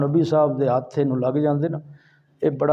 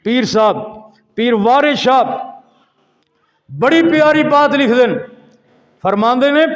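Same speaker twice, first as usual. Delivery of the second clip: loud, raised sermon voice; fiery preaching; 9 semitones higher, 7 dB louder than usual. Acted. Preacher introducing Waris Shah's verse, forceful preaching. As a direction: announce it forcefully to the crowd. Resumes preaching after sound check.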